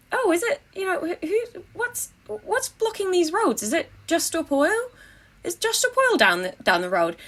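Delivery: high-pitched, mock-innocent questioning; feigned innocent curiosity. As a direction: play the clueless passer-by, voice lifted high. Acting clueless questioner to police.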